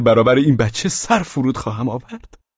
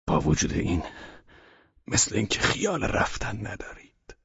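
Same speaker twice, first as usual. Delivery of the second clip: weary and low; 6 semitones lower, 10 dB quieter than usual. Acted. Weary host, voice dropping.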